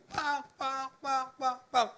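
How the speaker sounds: high, repeated hammering noise; tiruan bunyi ketokan. Retold or imitated. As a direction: imitate hammer blows on metal. (menirukan bunyi besi diketok)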